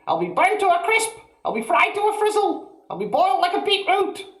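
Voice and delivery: put-on very high, loud old woman's shriek; panicked shout. A narrator's reading. Grandma shrieking for help, very high and loud.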